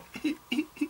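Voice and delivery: tiny high-pitched whimpers; little squeaky mock sobs, very quiet; crying whimper. Shown as a demonstration. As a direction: imitate a soft, whimpering cry. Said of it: "some people do the whimper".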